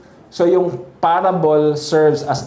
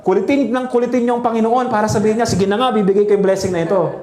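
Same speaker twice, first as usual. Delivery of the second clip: higher, quicker mock preacher; imitating that kind of preaching, pitched up and hurried; mimicked pushy preacher; 8 semitones higher, faster than usual. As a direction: urge it on like an insistent preacher. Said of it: "How many of you heard that kind of preaching?".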